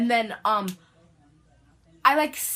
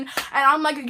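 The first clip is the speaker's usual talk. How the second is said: usual speaking voice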